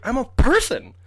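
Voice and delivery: high, loud indignant squeak; outraged squeal. Retold or imitated. To friends leaving him in Canada, high outraged squeal. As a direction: squeal it in disbelief at being abandoned.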